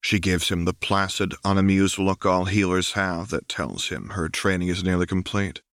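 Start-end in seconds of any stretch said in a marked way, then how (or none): none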